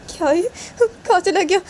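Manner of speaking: high, plaintive worried cry; anxious high-pitched wail; worried mother. Acted. Worried mother calls after Sanju.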